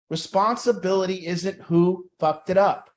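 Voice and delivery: usual speaking voice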